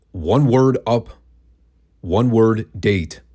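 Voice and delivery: slow, with UP and DATE each set apart; stressed separate words. Shown as a demonstration. Host explaining the botched caption, stressing "up" and "date" separately. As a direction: spell out the split: one word UP, pause, one word DATE. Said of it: "I do stress the words".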